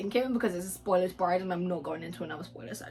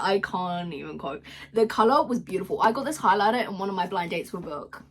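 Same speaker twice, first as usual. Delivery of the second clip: usual speaking voice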